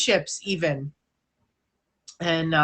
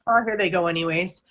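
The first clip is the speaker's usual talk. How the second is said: ordinary talk, nothing marked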